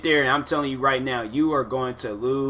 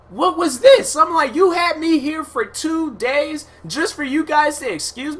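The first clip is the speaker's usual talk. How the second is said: high, loud, stunned retelling of the outburst; outraged disbelief; 14 semitones higher, 5 dB louder than usual. Retold or imitated. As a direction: replay the shock of being sent home. Retelling outrage at being excused.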